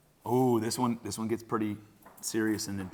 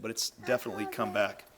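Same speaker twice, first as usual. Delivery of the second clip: ordinary talk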